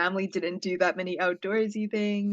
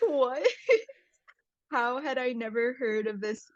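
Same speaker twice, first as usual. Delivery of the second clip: higher, laughing disbelief; incredulous reaction; 6 semitones higher than usual. Retold or imitated. Guest retelling the shock at the documentary, incredulous. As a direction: recall being baffled, half laughing through the question. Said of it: "I just remember being like".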